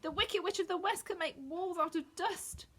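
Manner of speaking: high, tearful voice; Dorothy frightened of the witch; upset. A narrator's reading. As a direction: still shaken from crying. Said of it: "she cried".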